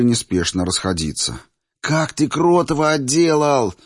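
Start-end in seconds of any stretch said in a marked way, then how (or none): 1.8-3.7 high, excited schoolboy admiration; admiring exclamation. A narrator's reading. Friend catching up after the fight, thrilled admiration. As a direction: marvel at your friend's victory, voice pitched up. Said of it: "восхитился Ираклий".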